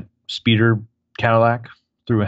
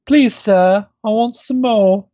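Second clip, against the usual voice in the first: high, loud Cockney orphan voice; Cockney urchin; 13 semitones higher, 5 dB louder, slower than usual. Acted. Hosts imagine the kid as Oliver Twist, high mock Cockney. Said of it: "has a really Cockney accent".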